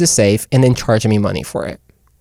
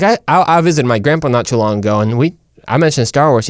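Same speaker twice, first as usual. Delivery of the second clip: ordinary delivery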